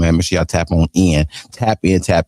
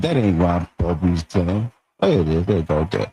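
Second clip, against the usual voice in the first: ordinary delivery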